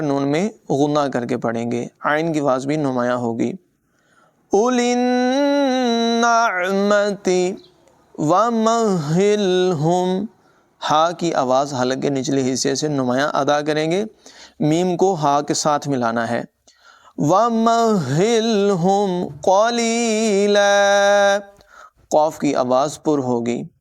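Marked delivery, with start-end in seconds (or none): 4.5-10.3 slow, higher melodic tajweed chant; Quran recitation. Shown as a demonstration. Teacher recites the verse for students, slow melodic chant. 17.2-21.4 slow, higher melodic tajweed chant; Quran recitation. Shown as a demonstration. Teacher recites the verse for students, slow melodic chant.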